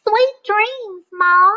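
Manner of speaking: a gosling saying goodnight to Jack; baby goose. Acted. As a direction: say it sweetly like a gosling. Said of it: "said the baby goose".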